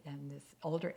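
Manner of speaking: usual speaking voice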